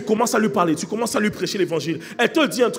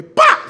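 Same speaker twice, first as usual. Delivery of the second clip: sudden loud, high "paf"; imitated gunshot; 12 dB louder than usual. Preacher miming the sniper firing, explosive gunshot sound. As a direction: burst it out like a shot.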